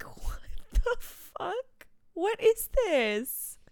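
high, slow, drawn-out mock wail; exasperated disbelief. Reacting to another dead dog story, exasperated disbelief. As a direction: stretch each word in comic despair.

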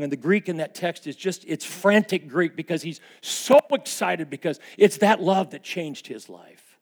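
loud, high fervent preaching; preaching at full force. Acted. Pastor confessing his anger to the church, loud fervent preaching. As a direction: drive it home to the congregation.

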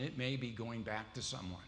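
normal talking voice

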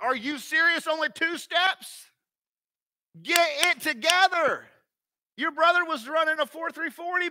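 put-on high, loud, scolding disbelief at a toddler; mock exasperated parent. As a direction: berate the baby like a sports coach. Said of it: "my reaction wasn't".

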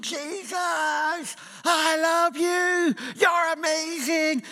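high, drawn-out ecstatic shout of praise. Retold or imitated. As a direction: call out slowly to Jesus like a crazy praiser at the back. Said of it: "He would be standing at the back shouting".

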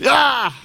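sudden high loud cry of rage; a burst of fury, no words; angry yell. Retold or imitated. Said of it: "And Russ gets angry".

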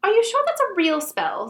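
high, prim know-it-all girl impression; Emma Watson. Reader does Hermione questioning a spell, impression voice. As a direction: impersonate an eager little know-it-all, pitched high. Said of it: "do it in my Emma Watson impression voice".

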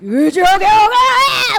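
high, loud battle cry; ultimate shout. Retold or imitated. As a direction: yell the ult at full force. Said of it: "so hard to do".

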